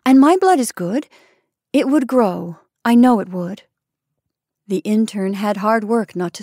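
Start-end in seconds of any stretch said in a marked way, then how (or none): 0.0-3.6 louder, higher opening, earnest insistence; urging. A narrator's reading. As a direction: make an eager case to the doctor. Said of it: "urged the probationer".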